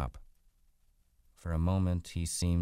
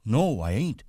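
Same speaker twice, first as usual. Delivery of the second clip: higher, louder simple-man denial; earnest protest; 8 semitones higher, 7 dB louder than usual. A narrator's reading. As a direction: insist earnestly, like a big child. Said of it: "Lenny explained earnestly".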